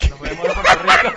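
laughing goodbye. Real, not acted.

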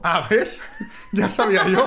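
laughing out loud in triumph. Real, not acted. Husband laughing that she copied an influencer, laughing. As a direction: laugh through it, caught you.

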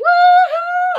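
high, loud celebratory whoop; cheering whoop. Real, not acted. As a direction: cheer at full voice.

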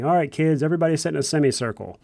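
rally the little ones like a chirpy tour guide; tour guide to kids. Retold or imitated. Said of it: "on a tour of some place and saying".

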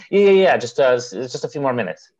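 imitating a hasty, loud brush-off; mock stalling, high and loud at first; stalling friend. Friend putting off leaving his host's house, stalling.

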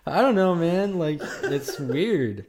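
talking through laughter, high and loud; laughing. Real, not acted. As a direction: speak while still laughing. Still laughing after joke.

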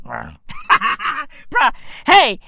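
usual speaking voice

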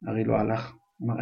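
usual speaking voice

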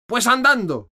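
very high, very loud roar. A narrator's reading. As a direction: roar the order to march. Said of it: "rugió la reina". (rugido de la reina, muy fuerte)

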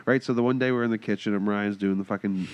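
usual speaking voice

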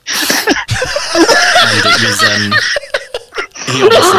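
high squealing laughter through the words; can't get it out for laughing; breaking up with laughter mid sentence. Real, not acted. Laughing fit mid story.

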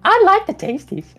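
very high squeaky bickering head; two bickering giant voices. Acted. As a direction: squeak it out, insisting like a greedy monster. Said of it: "they're bickering back and forth".

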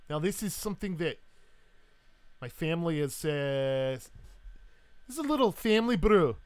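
proud Scottish host; Highland Scot. Acted. Scot presents his family brew, proud Highland accent. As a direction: Highland brogue, building to a loud challenge. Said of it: "this world's equivalent of a Highland Scot".

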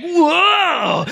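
loud, high whoa; startled whoa. Retold or imitated. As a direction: jump out shouting whoa. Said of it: "and he's like".

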